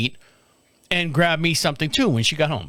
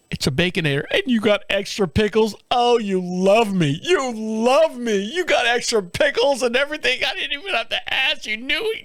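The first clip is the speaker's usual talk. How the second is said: mock tearful gushing; 7 semitones higher than usual. Husband thanks wife for extra pickles, mock tearful. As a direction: gush like it's the best gift ever. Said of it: "I'm crying".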